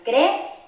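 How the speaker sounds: "creer" split as cre, er; dos sílabas separadas. Shown as a demonstration. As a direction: say "creer" with a clear break between the two vowels. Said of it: "pronunciar en dos sílabas diferentes". ("creer" separado en dos sílabas: cre, er)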